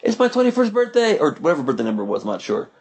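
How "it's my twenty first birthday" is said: high, excited party shout. Retold or imitated. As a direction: call it out to the whole patio. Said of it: "shouts to everybody".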